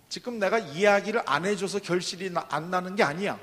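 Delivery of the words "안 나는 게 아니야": normal talking voice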